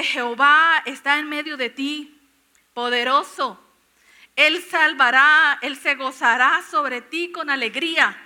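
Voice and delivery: loud, drawn-out proclamation; forceful scripture declamation. Acted. Preacher reading Sofonías to whoever needs it today, proclaiming. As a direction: proclaim the promise with mounting volume.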